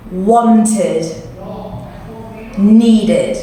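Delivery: "Wanted", pause, "Needed", both ending in an id syllable. Shown as a demonstration. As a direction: model "Wanted" and "Needed", each ending in a clear "id". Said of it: "can you say it with me".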